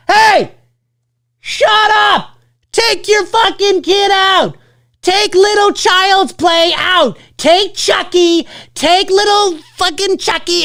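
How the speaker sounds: high, drawn-out shout across the theater; slow, high-pitched angry call-out; mock heckling yell. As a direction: yell at a parent to get out. Acts himself yelling at a parent.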